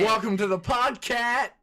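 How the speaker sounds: goofy host voice. Acted. Son mangling the intro playfully.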